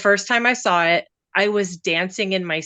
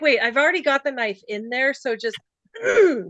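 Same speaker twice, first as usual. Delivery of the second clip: higher, mischievous director twisting the knife; playfully cruel, raised pitch; teasing filmmaker adding more pain; James Gunn; 6 semitones higher than usual. Retold or imitated. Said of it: "James Gunn was like".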